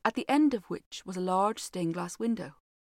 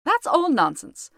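higher, louder scoffing cry; cried out; 7 semitones higher, 8 dB louder than usual. A narrator's reading. Washington brushing off the housekeeper, loud and scoffing. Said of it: "cried Washington Otis".

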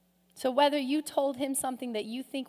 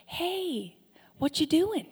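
lightly voiced, higher-pitched casual check-in; God; 5 semitones higher, slower than usual. Preacher plays God checking on someone hiding, casual call. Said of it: "That's how God talks to us".

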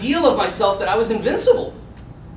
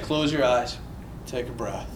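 low, hushed calming instruction; soothing medic; 8 semitones lower, 11 dB quieter than usual. Retold or imitated. Medic to patient lying on table, low soothing hush. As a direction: lull the patient, getting softer.

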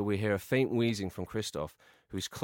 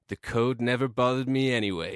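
movie quote voice; 3 semitones higher, 4 dB louder than usual. Acted. Frozen line quoted in parody.

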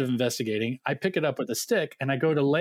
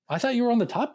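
high, quick blurt of disbelief; alarmed surprise; 10 semitones higher, faster than usual. Retold or imitated. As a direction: blurt it out in a higher pitch. Alarmed at pranking the wrong bed.

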